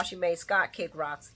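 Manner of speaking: ordinary talk, nothing marked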